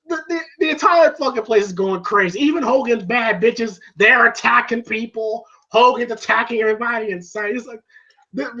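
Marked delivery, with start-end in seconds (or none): none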